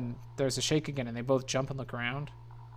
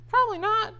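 far above the usual pitch; high squeaky answer; 5 dB louder than usual. Acted. Friend answering about Jenna's safety, very high voice.

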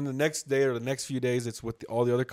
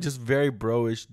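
ordinary delivery

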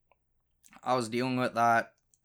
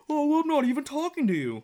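high mocking falsetto of her dismissive voice; mocking girlfriend; 4 dB louder than usual. Imitating his girlfriend brushing him off, high and mocking. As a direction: sneer it back in her high put-on tone. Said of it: "acting like".

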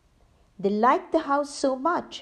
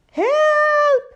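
high, loud cry for help; loud frightened help; terrified cry; 9 dB louder than usual. Acted. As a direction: scream it in a panic. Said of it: "cried the robbers".

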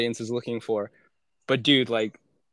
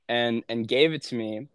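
ordinary delivery

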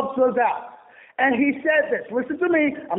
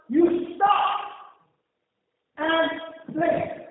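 ordinary delivery

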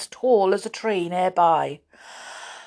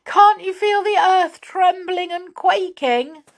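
frightened; 12 semitones higher, 4 dB louder than usual. Acted. Franny frightened by the earthquake.

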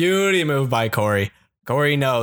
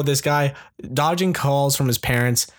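ordinary talk

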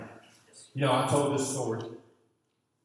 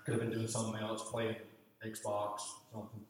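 sulky muttering; 3 semitones lower, 11 dB quieter than usual. Retold or imitated. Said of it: "I'm thinking".

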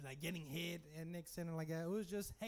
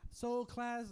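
teacher voice; 6 semitones higher than usual. Retold or imitated. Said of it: "this is what happened".

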